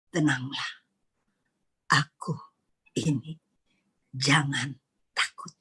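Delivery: soft and low, fading into a whisper; gentle reassurance. Acted. Jesus quieting frightened disciples, tender and hushed. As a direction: hush fear with a calm voice.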